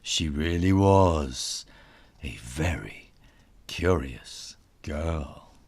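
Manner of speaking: ordinary delivery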